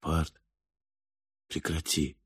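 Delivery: very soft, hushed voice; whispered plea. A narrator's reading. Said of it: "прошептал он". (тихо, почти шёпотом)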